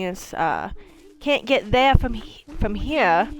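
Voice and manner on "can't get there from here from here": Mainer accent. Shown as a demonstration. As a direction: put on a Mainer accent with dropped endings. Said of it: "trying to say it in my Mainer accent".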